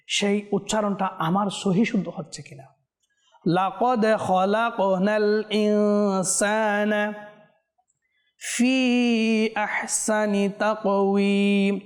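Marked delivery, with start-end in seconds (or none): 3.5-11.8 slow, drawn-out melodic tajweed chant; Quran recitation. Shown as a demonstration. Teacher reciting a verse for viewers to follow, slow melodic chant. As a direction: chant each syllable long and measured.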